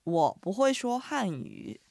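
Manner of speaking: slow, careful Mandarin; slow model phrase. Shown as a demonstration. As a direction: say it slowly for repetition. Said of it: "発音と合わせて言ってみましょう".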